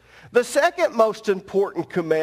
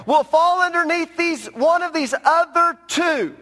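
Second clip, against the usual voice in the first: higher, pressing sermon delivery; fervent preaching; 8 semitones higher, 4 dB louder than usual. Acted. Preacher urging congregation on commandments, fervent preaching. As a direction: drive the point home to the congregation.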